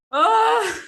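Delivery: loud, high winded "oh"; out-of-breath groan. Real, not acted. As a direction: let out a big puffed-out groan. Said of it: "huffing and puffing".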